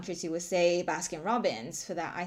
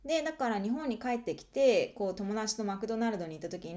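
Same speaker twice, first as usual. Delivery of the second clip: ordinary talk, nothing marked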